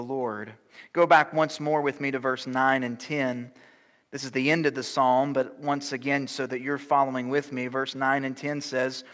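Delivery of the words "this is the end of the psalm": ordinary delivery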